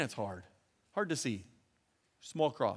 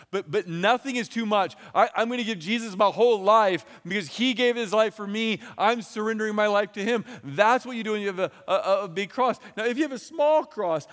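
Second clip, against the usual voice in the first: loud, raised, full-force preaching; fervent preaching; 5 semitones higher, 6 dB louder than usual. Acted. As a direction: preach it with all your heart.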